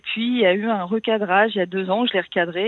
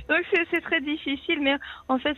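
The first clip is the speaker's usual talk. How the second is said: nervous laugh; 9 semitones higher than usual. Real, not acted. Nervous laugh, then strained.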